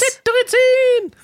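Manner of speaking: very high, slow, stretched word; drawn-out high joke. Shown as a demonstration. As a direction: stretch Cetirizin way up. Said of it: "Kleiner Scherz".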